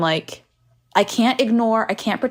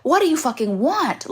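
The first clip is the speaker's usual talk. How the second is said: exasperated; 6 semitones higher, slower than usual. Retold or imitated. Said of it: "And I go".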